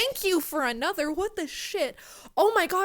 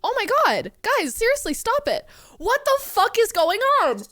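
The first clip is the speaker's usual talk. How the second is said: high, loud, incredulous protest; real shocked plea for them to stop; overwhelmed disbelief; 7 semitones higher, 7 dB louder than usual. Real, not acted. Streamer to generous viewers as donations pour in, stunned disbelief.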